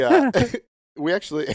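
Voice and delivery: laughing, fast. Real, not acted. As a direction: laugh through the words. Laughing mid-sentence.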